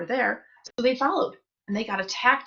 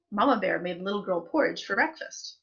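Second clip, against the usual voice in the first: ordinary delivery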